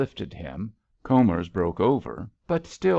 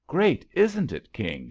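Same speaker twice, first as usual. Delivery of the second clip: ordinary delivery, nothing marked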